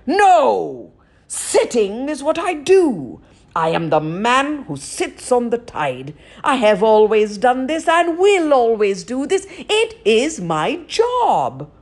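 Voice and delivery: loud, stubborn giant; angry giant voice. Acted. Giant refuses Raven angrily.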